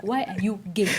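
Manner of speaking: quoting a viral Ugandan clip; Ugandan clip quote. Retold or imitated. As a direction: deliver it like the famous clip. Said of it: "Even my friends are like".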